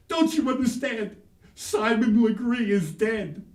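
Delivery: put-on high, loud little boy insisting; child's voice.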